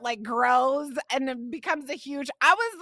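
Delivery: usual speaking voice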